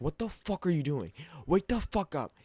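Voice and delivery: retold dad yelling. Dad yelling at him at dawn.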